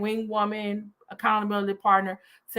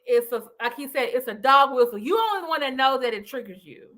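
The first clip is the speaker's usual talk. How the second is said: ordinary talk, nothing marked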